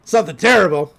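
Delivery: a hint of a grumbling old man, fast; second heckler. Recapper voicing the second balcony heckler, lightly grumbling. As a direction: grumble the punchline back. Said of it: "He's like".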